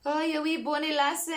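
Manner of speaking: hammed-up amateur Scottish lilt; Jock. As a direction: put on a playful Scottish cartoon voice. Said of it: "his wee Scottish accent".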